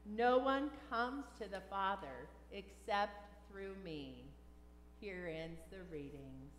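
usual speaking voice

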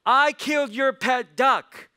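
high, slow shouted confession; loud confession. Acted. Boy confessing to his grandma, high and loud. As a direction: blurt it out at full volume.

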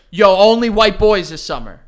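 loud pretend hype-man shout-out; mock song hype. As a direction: belt it out like a parody party anthem intro. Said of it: "released a song that was like".

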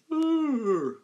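high, loud yawn sliding down; exaggerated yawn, starting high and falling; acted yawn. A narrator's reading. Tiny gnat unworried by the lion, big showy yawn. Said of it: "opened his tiny mouth and yawned".

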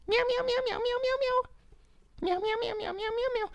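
squeaky high-pitched meowing; high cat meows. Pretend. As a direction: meow like a tiny kitten.

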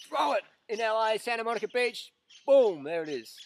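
mock throw. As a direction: ham up the throw all the way to LA. Miming throwing laptop to LA.

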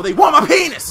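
loud, high yell. Real, not acted. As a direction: yell it out over the fight. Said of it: "sorry for yelling so much".

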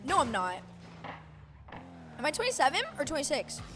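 usual speaking voice

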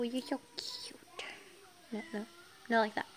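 usual speaking voice

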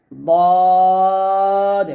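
দদ held long and thick, closing on d; heavy Dad. Shown as a demonstration. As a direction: model দদ on one sustained note. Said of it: "এইভাবে উচ্চারণ করা হবে".

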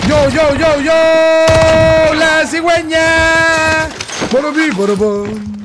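high stretched-out hype yell; excited shouting. Real, not acted. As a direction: shout it like a victory chant. Hyped shout after a game kill.

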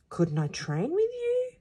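put-on high-pitched boyish plea; begging. A narrator's reading. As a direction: beg to be let in, voice pitched high. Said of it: "begged Bruno".

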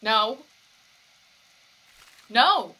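loud pleading no; loud anguished protest; desperate no. Real, not acted. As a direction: shout it at the screen. Desperate reaction to scene.

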